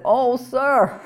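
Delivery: a frightened servant pleading with his master; crying out in fear. Acted. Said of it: "he cried to Elijah".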